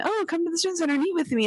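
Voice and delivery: high, fast eager invitation; mock clingy friend, high and quick. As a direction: chirp it like an over-keen friend. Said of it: "I'm not gonna be texting".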